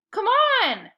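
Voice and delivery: high, loud call across the field; impatient boy yelling from the soccer game. Acted. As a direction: yell it to a friend far off. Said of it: "yelled Eric".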